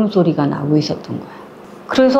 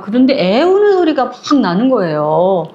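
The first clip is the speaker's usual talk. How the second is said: ordinary delivery, nothing marked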